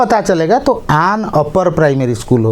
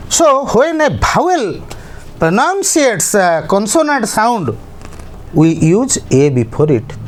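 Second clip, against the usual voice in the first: slow dictated rule; much slower than usual. Shown as a demonstration. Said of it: "teacher dictating rule".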